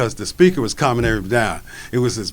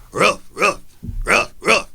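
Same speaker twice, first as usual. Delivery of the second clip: dog bark imitation; 6 semitones higher than usual.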